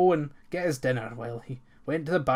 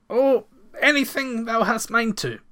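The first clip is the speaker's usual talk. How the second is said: stammered; 9 semitones higher, 6 dB louder than usual. A narrator's reading. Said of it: "stammered the farmer".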